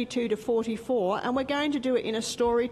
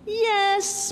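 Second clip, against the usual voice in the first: sharp objection; 9 semitones higher, 5 dB louder than usual. Acted. Critics object in story.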